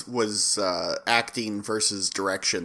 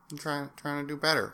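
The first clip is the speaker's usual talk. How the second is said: mumbled; 2 semitones higher, 10 dB quieter than usual. Retold or imitated. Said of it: "he's like".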